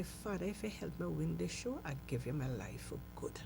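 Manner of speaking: soft, hushed plea to God; lying in bed, quietly begging God; quiet prayer. Retold or imitated. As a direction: pray it under your breath.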